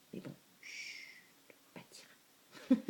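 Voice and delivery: playful secretive whisper. Pretend. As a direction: hush it like a secret. Sharing a secret, hushing viewers.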